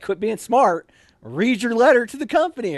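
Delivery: mock drill sergeant barking orders; drill sergeant scolding. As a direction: snap at him to stop joking. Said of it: "She's like".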